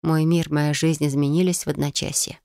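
ordinary delivery, nothing marked

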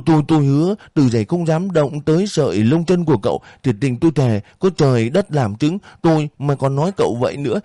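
high, fast, stammering plea; landlady begging the boy, high and rushed; panicked begging. Acted. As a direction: grovel in panic. Landlady swearing oaths, pleading.

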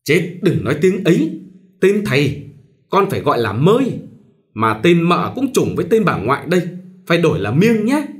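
scolding the daughter-in-law about taboo names; lightly voiced mother-in-law. A narrator's reading. Mother-in-law lightly voiced, fussy and bossy.

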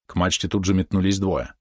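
ordinary delivery

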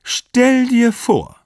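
very high, loud, drawn-out exclamation; acted high-voiced wife exclaiming; gushing amazement. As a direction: gush it slowly, amazed at the news. Wife gushes on the phone.

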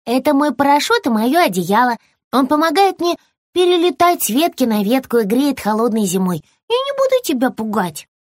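high squirrel voice, lecturing; a small squirrel defending his tail; little squirrel, a bit cross. Acted. Squirrel figure held voice.

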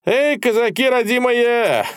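high, slow, drawn-out holler; shouted hail. A narrator's reading. Victorious soldier hailing the Cossacks, long high shout. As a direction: hail them loudly across a field. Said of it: "кричал молодец".

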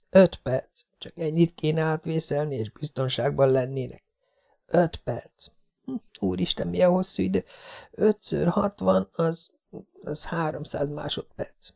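put-on high, hushed, anxious girl; nervous inner voice. A narrator's reading. Jessica steels herself for five minutes, high and hushed. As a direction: whisper it to yourself, scared.

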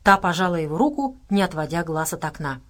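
usual speaking voice

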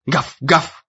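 voiced woof woof; dog bark. A narrator's reading. The dog barks at Ian, a doggy bark.

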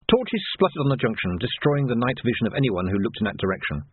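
ordinary delivery, nothing marked

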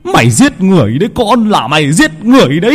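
furious father. A narrator's reading. Father berates son for murder.

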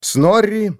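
ordinary delivery, nothing marked

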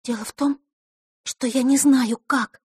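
put-on high, anxious girl's voice; frightened whisper. A narrator's reading. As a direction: confess it fearfully in a high voice. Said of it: "со страхом прошептала она". (испуганно, высоким голосом)

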